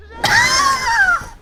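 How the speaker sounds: loud, very high sustained squeal; high gleeful squeal. Real, not acted. Player delighted by armor breaks, high loud squeal. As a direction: squeal loudly at the top of your voice.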